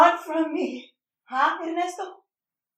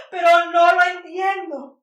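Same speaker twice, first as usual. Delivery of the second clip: louder, tearful cry; 3 semitones higher, 4 dB louder than usual. Acted. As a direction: break down in tears on the words. Mother breaking into tears.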